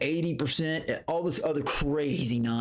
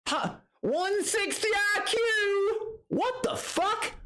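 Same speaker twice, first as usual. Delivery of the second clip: very high, slow squeaky cry of disbelief; mocking, stunned squeal at the boast; slower than usual. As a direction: stretch it out high like you can't believe it. Mocking reaction to Bert's boast.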